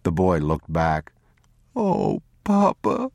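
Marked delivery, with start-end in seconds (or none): 1.8-3.1 put-on high, drawn-out child's wail; sobbing. A narrator's reading. Boy looks back at the abandoned man, sobbing. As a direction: sob slowly to your father. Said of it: "he sobbed".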